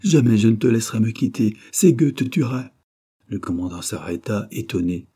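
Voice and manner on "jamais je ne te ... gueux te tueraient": loud, pleading protest; feigned despair. A narrator's reading. Wife refusing to let husband go, feigning despair loudly. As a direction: overplay a sudden desperation. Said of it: "en feignant un subit désespoir".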